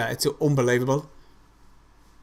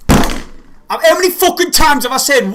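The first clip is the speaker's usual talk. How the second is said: loud, high, slow rage; furious shout; 11 semitones higher, 7 dB louder, slower than usual. Real, not acted. Fan raging at his team for leaving Wallace free, furious shout. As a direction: bellow it in disbelief.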